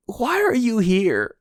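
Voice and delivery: high, slow, exasperated cry; mock exasperation. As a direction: draw it out in hammed-up disbelief. Acted exasperation at Caribou turning up.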